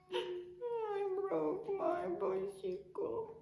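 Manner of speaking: high, slow fake sob; mock crying. Teacher acts out a sad child, mock crying. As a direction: whimper like a child over a broken toy. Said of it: "You get very sad".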